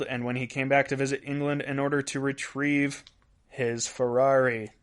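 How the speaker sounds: ordinary delivery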